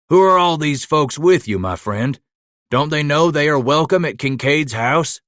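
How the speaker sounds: Mateo's hearty voice. A narrator's reading. Old Mexican host booming a welcome to his friend. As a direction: greet an old friend with open arms.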